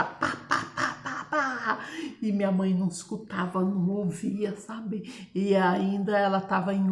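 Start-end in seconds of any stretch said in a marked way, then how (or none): 0.0-1.8 soft whispered knock sounds; imitated knocking. Retelling father banging on the door, whispered knock sounds. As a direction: tap out the knocks under your breath. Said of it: "batia na porta".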